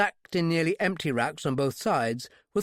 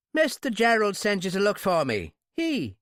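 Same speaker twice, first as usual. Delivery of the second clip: baffled Irishman, pitched up; 6 semitones higher, 3 dB louder than usual. A narrator's reading. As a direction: puzzle it out in an Irish accent. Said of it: "as Irish as a sprig of shamrock".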